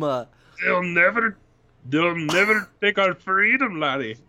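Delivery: slow, hammed-up Scottish with a laugh breaking in; mock Scottish accent. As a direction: drag it out like a movie Scotsman, ending on laddie. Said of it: "That's the worst Scottish accent".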